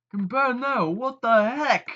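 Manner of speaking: high, loud disbelief, slowing on the second phrase; shocked. Acted. Losing player reacting to the dentist card, shocked and high. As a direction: gasp in outrage at the cards.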